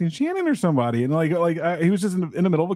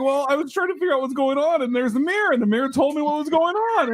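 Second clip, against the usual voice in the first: high, animated retold reply; excited retelling; 8 semitones higher than usual. Storyteller quoting own reply to everyone, high and animated. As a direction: pitch it up, gleefully explaining.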